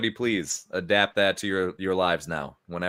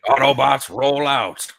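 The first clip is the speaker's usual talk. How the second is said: louder heroic robot command; Optimus Prime; 3 semitones higher, 7 dB louder than usual. Retold or imitated. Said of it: "I could picture Optimus Prime being like".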